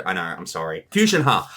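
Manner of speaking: normal talking voice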